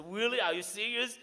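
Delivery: high, loud, incredulous Really?; amazed disbelief. Retold or imitated. To the man offering a room, amazed and loud. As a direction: retell the joy of hearing you get a room.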